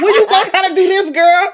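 high-pitched, laughing through the words. Real, not acted. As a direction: giggle it out, fast and loud. Laughing while telling.

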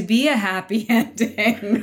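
laughing through the last words. Real, not acted. Laughing as she wraps up her point on life stories. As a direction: let a real laugh break into ending.

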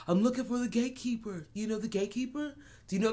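high, lightly voiced possessed wanderer; a hint of a dazed possessed man asking around; possessed keymaster. Podcaster imitating the possessed keymaster, pitched high. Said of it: "He was like".

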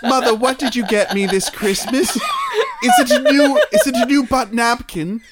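put-on high, excited child pleading with mother; hammed-up Christmas-morning kid, half laughing; mock British child. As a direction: squeal it like an eager British child. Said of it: "You have the same accent for all moms".

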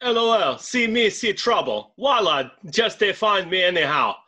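put-on lightly Nigerian-accented read of a taunt; Israel impression. Producer reads Israel's post for the hosts in mild Nigerian accent. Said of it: "do your best Israel impression".

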